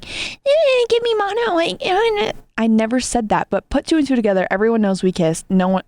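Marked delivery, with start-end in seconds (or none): 0.0-2.3 much higher, goofy sing-song mock voice; mock the idea in a ridiculous squeaky voice; mocking silly voice. Mocking the ex's accusation to her friend, high goofy voice. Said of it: "You think I'm out here saying".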